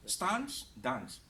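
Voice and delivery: British long "ah" in "Stance, dance"; British pronunciation. Shown as a demonstration. Teacher offering a choice of accents, British pronunciation. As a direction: say "stahnce, dahnce" the British way. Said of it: "British versus American is your choice".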